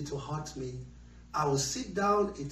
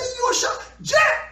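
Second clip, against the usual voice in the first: high, loud scolding shout; shouted rebuke; 9 dB louder, slower than usual. Acted. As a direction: yell to silence him. Crowd shouting him down.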